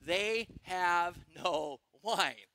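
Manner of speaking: very slow, word by word; deliberate four words. Acted. Preacher quoting Mary's four words, slow and deliberate. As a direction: draw out each of the four words. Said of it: "four words".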